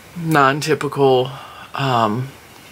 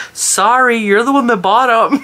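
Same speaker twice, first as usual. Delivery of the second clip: mock innocent; 7 semitones higher, 5 dB louder than usual. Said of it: "I was like".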